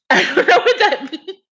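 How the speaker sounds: high, helpless laughing delivery. Real, not acted. Dreamer baffled by her own dream, laughing. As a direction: squeeze the words out through laughter.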